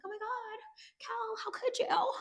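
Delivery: high-pitched hushed mock dismay; mock shocked mom. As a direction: squeak it out like a scandalized mom. Mimics an oblivious, shocked mom.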